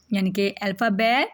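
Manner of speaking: ordinary delivery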